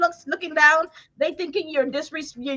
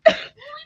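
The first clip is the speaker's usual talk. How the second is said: shrieking laugh; 12 semitones higher than usual. Real, not acted. Laughing shout.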